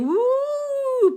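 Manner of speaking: high, loud spooky hoot; long rising ghost "Woo"; ghostly woo. Acted. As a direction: wail like a ghost.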